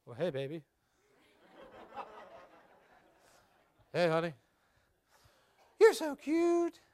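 lightly sultry come-on; sensual voice. Shown as a demonstration. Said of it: "delivered in a sensual way".

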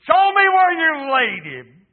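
high, very loud drawn-out shout; explosive preacher's roar as Jesus; sudden outcry. Acted. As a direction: bellow it as a slow command. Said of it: "Jesus said these words".

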